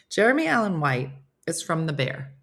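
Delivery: normal talking voice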